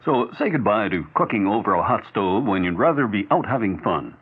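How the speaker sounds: usual speaking voice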